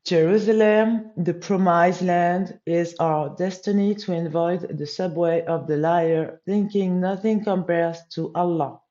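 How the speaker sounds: loud, slow, declamatory English reading; solemn text recitation. Real, not acted. Reading the English text aloud to viewers, loud, slow recitation. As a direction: proclaim it line by line, drawn out.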